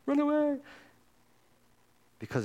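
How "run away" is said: sudden high, loud cry to flee; panicked yell. Acted. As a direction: yell it like someone fleeing in terror. Preacher acts out fleeing the mountains.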